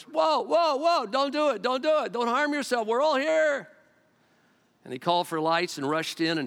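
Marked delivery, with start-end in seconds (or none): 0.0-3.7 much higher, louder call to stop him; Paul crying out, higher and louder, to stop the jailer; urgent loud cry. A narrator's reading. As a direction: shout out to save the jailer. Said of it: "Paul cried out with a loud voice".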